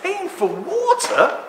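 high, slow, stunned disbelief; alien disbelief. Retold or imitated. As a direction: gasp at it like a baffled visitor from another planet. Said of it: "Imagine someone coming from another planet".

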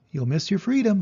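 high, loud goose voice; the goose pleading with Wilbur, high and loud; goose honking. A narrator's reading. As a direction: honk it at him. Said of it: "honked the goose".

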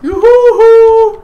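very high, slow drawn-out whoop. Real, not acted. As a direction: whoop with joy on a fast ride. Whooping on ride. (신나서 길게 환호하기)

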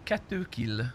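usual speaking voice

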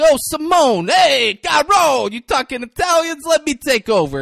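loud, high mock Italian accent; Braden. Host imagines co-host in the commercial, shouted Italian accent. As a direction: burst in as a hammed-up Italian. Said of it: "doing an Italian accent".

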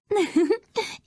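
high, bright little giggle; a short happy princess laugh; light laugh. Acted. Princess laughing to herself, light giggle.

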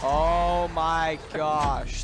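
very slow, stretched-out exclamation of dismay; drawn-out disbelief. Real, not acted. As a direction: stretch every word in disbelief. Dismay at another failure.

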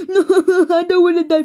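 high, hammed-up sobbing plea; tearful pleading. Victim pleading during a bomb countdown, tearful. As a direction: beg through mock sobs.

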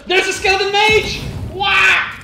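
loud, high alarmed yell; alarmed shout. Real, not acted. Alarm at an enemy.

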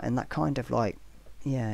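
ordinary talk, nothing marked

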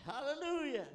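high, loud cry of praise; praise shout. Real, not acted. Preacher praising the Lord, high and loud. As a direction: ring it out over the congregation.

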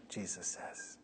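soft whisper, dropping away; whispered aside. Acted. Preacher whispering the attribution to the congregation. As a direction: breathe it out almost silently.

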